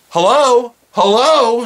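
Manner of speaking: retold screaming. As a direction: shout Hello? like an impatient customer. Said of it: "She just started screaming like that".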